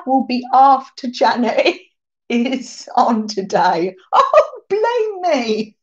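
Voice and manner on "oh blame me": high, slow mock offense; hammed-up hurt protest; mock offended. Mock complaint after being blamed.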